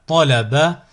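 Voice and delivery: syllables joined as one word. Shown as a demonstration. Teacher models a word for learners to repeat, joined in one go. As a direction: run the syllables together without pauses. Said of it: "le mot attaché".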